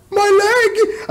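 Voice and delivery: very high cartoon squeal; mock pained shriek. Mimicking the show's old injury joke, very high squealed mock cry.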